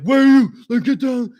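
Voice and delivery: high, loud mock cop shout; cop yelling. Cops running at him in the dark, high loud yell. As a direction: bark the order.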